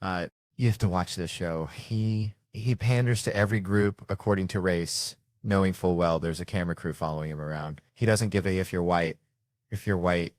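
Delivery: ordinary delivery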